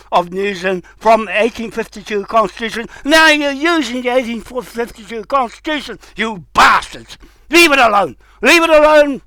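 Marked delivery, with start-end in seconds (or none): 3.0-7.2 furious shout. Real, not acted. Furious rant at David Seymour. 7.5-8.2 angry demand. Real, not acted. Furious rant at David Seymour. 8.4-9.2 higher, louder furious shout. Real, not acted. Ordering David Seymour off the constitution, furious shout.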